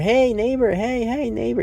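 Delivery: high, eager hey neighbor call; overly friendly neighbor. Retold or imitated. Pushy neighbor greeting Gru across the yard, high and chummy. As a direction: sing it out way too friendly. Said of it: "he's, like, waving his arm".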